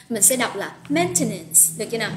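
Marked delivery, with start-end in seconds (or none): 0.9-1.6 "maintenance" with the first n and t both sounded; dictionary pronunciation. Shown as a demonstration. Teacher models the dictionary transcription for learners, fully pronounced "maintenance". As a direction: say "maintenance" in full, men-te-nence. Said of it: "phiên âm như cô đang phiên âm đây".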